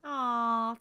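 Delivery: slow, moved aw with a catch of laughter; real, slow touched thanks; touched and grateful. Real, not acted. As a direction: melt at the kind words, close to tears. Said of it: "I'm gonna start crying".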